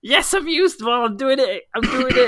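high, loud gloating; mock triumphant. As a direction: crow it out like a winner. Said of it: "and I was like".

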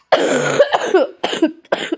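harsh cough; coughing fit. Real, not acted. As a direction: cough hard, hoarse. Sick gamer coughing.

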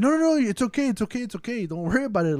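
high-pitched retold reassurance, easing down; imitated quick reassurance. Quoting her brushing off his apology.

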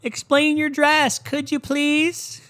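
put-on high, slow, drawn-out girly voice; singsong request from an entertainment reporter; mock red-carpet interviewer. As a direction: stretch it out in a fake high female voice. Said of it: "a fucking girl in an E with an E microphone".

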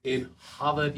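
hammed-up Boston accent; mock Boston accent. Shown as a demonstration. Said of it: "something with a Boston accent".

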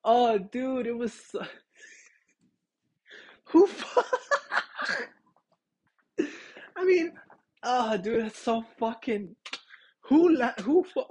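high-pitched, laughing through broken phrases; too tickled to finish a sentence; cracking up. Real, not acted. Podcaster recalling a girl speaking in third person, cracking up.

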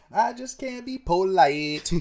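slow squeaky parody, laughing; mock high voice. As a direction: laugh through a silly high echo. Friend echoes in silly voice.

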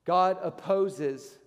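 "God opposes" drawn out slowly; grave measured emphasis; slow solemn warning. Acted. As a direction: weigh each word. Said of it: "I'm just here to tell you".

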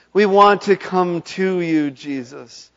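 preacher shouting the refrain to lead the crowd; full-force repeat. Acted. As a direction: bellow it as the climax of the call. Call repeated far louder.

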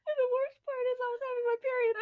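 high, tearful, breaking into laughs; crying with laughter. Real, not acted. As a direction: struggle to finish through the laughter. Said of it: "we're crying laughing".

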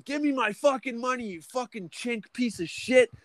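very high, strained threat; retold gunpoint demand. Gunman demanding his wages from the boss, very high strained. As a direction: shriek the demand like you're losing it. Pointing a gun, demanding money.

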